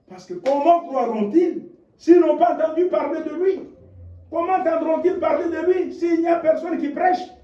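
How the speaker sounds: high, shouted rhetorical questions; preaching at full force. Acted. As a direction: hammer each question home. (prédication à pleine voix, questions criées)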